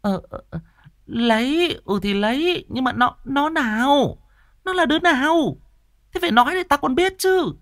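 high, hesitant stammer turning to shrill questions; stammering mother. Acted. Mother stammering agreement then pressing her son, high and flustered. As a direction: stumble then press him. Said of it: "Bà Nụ ấp úng".

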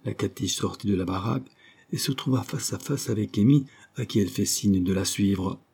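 plain reading voice

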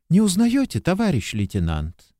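bright hopeful question; young woman's voice. A narrator's reading. Young woman greets him.

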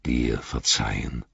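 low, slow, weighty reply; stern wizard. A narrator's reading. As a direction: answer gravely, in a low voice. Wizard answers the plea sternly. (tief, langsam und streng)

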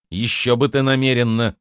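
ordinary delivery, nothing marked

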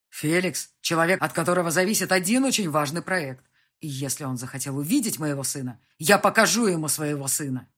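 loud, insistent male argument; forceful insistence. A narrator's reading. Husband insisting he will show off his son, forceful. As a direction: press the point firmly and loudly.

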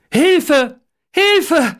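high, desperate cry for help; urgent, high-pitched shouts; calling for help. A narrator's reading. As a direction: call out loudly in distress. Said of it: "ruft er laut".

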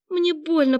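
high pained wail; pained moan. A narrator's reading. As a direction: moan it out in hurt protest. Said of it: "простонала она".

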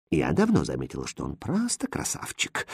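soft, low, languorous swoon; languid. A narrator's reading. First lady admires the suitor, languidly. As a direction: croon it softly and low. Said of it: "томно пропела первая дама".

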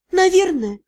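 usual speaking voice

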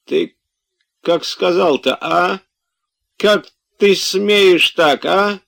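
high, loud outrage; indignant scolding. A narrator's reading. Master rebuking servant for rude words, indignant. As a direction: demand an answer, pitched right up.

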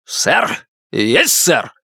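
sharp military reply; stiff barking toy soldier. A narrator's reading. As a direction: snap to attention. Soldier snaps to attention. (чёткий армейский ответ)

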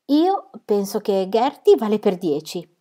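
irritated retort, slow and emphatic. A narrator's reading. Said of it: "rispose Connie irritata".

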